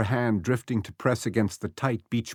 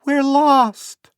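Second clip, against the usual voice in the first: put-on high, loud child's cry; a small boy yelling for rescue; boy shouting; 9 dB louder than usual. A narrator's reading. Lost boy calls to Becky, shouting high. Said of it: "the boy shouted".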